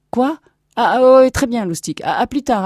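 high, startled stammer; flustered reply. Acted. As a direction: answer loudly, caught off guard. Distracted captain dismisses crewman.

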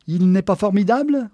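high, loud swooning admiration; delighted. A narrator's reading. Geneviève admires Clark Gable on screen, delighted. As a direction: gush over a film idol. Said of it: "Elle en gloussait de plaisir".

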